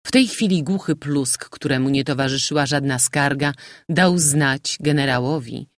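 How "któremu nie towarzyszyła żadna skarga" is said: usual speaking voice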